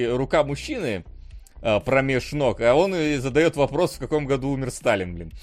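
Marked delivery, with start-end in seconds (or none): none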